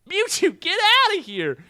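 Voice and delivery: high, loud annoyed shout; mock exasperated yell. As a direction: yell him away in exasperation. Ash yells at Mewtwo.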